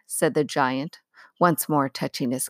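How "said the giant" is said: ordinary delivery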